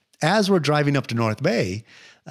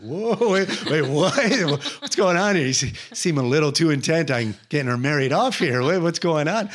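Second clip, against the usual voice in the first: higher-pitched, startled retelling; 5 semitones higher than usual. Retold or imitated. Retelling her unexpected marriage question, startled. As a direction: act out being taken aback, voice jumping up.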